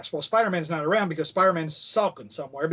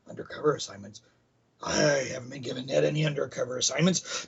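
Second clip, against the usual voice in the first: Robbie drops to a puzzled whisper; hushed surprise; 3 semitones lower, 4 dB quieter than usual. Retold or imitated. Robbie answering Betty in a baffled whisper. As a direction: whisper it as if nobody should overhear. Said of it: "Robbie's going".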